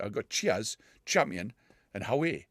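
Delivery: ordinary talk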